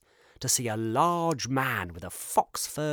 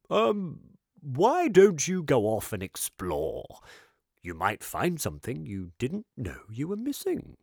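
higher, louder character voice, jolly and knowing; kindly eccentric shopkeeper; 4 semitones higher, 3 dB louder than usual. Acted. Shopkeeper teasing Kip gently about being lost. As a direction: charm the lost boy.

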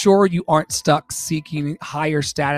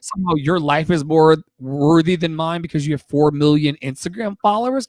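usual speaking voice

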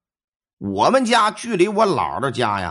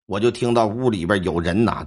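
ordinary delivery, nothing marked

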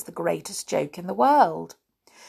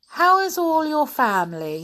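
higher, louder sly friendliness; friendly hobgoblin; 10 semitones higher, 5 dB louder than usual. A narrator's reading. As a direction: sound all friendly, hiding mischief. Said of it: "he asked in a friendly manner".

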